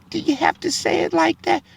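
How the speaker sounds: high, soft, hammed-up complaint; offended listener. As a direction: play a prissy, offended churchgoer. Mocking offended churchgoers.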